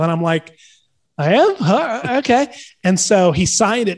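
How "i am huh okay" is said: high, puzzled questioning; retold bewilderment. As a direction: react unsure, each bit lifting high. Retold puzzled reply to praise.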